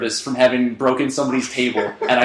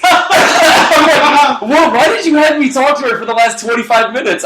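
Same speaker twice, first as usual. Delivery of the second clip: laughing; 11 semitones higher, 6 dB louder, slower than usual. Retold or imitated. Indignant retold quote, laughing.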